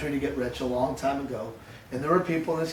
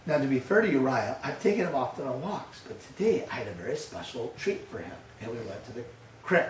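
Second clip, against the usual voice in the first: ordinary talk, nothing marked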